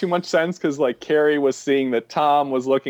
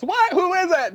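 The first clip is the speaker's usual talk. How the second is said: imitated yelp, pitched way up; Tom Hanks shout. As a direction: do his high, exasperated door shout. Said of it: "he just shouts".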